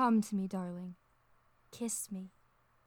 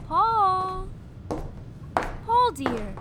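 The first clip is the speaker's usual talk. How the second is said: calling out; 8 semitones higher, 8 dB louder, much slower than usual. Acted. Calling for husband again.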